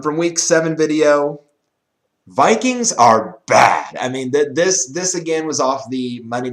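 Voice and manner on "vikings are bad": "bad" punched loud and drawn out; slow imitated quote, landing hard on "bad"; stress on bad. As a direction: hit the word "bad" with heavy emphasis. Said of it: "he emphasized the word bad".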